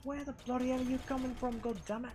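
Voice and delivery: high, fast flustered outburst; startled yelling. Real, not acted. Gamer ambushed by enemies, fast high flustered shout. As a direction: caught off guard and rattled. Attacked mid-run in game.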